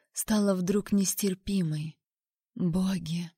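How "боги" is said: softer, lightly croaked exclamation; hoarse gasp. A narrator's reading. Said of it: "прохрипела я".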